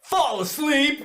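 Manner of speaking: high, drawn-out triumphant exclamation; hyped punchline. Acted. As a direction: belt it out as a gleeful punchline. Punchline answer to badass question.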